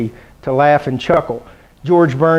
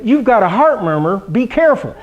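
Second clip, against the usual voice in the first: doctor's warning; 5 semitones higher than usual. Retold or imitated. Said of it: "I said".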